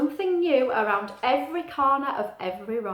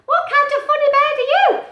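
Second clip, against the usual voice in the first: high, loud chirpy bird voice; little bird voice; 10 dB louder than usual. Acted. Little bird greeting Pete, high and loud. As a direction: squeak it out like a tiny bird.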